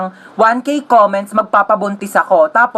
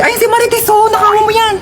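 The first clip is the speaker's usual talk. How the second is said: high excited pointing; 10 semitones higher than usual. Acted. Pointing robber elsewhere.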